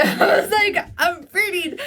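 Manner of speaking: high laughing squeal through the words. Real, not acted. Guest laughing at her own botched karaoke, high laugh. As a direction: laugh it out, pitch way up.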